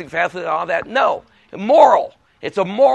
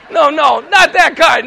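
louder, higher string of noes; retold protest; 7 semitones higher, 6 dB louder than usual. Speaker correcting a man about scripts, insistent retold protest. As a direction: cut in to correct a misunderstanding.

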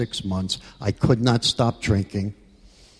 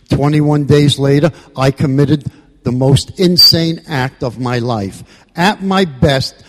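rising passion; 4 semitones higher, 5 dB louder than usual. Real, not acted. Building impassioned climax of testimony.

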